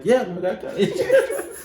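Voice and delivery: high, laughing yes, delighted; laughing glad reply to a fellow student. Retold or imitated. Retells her own happy reply.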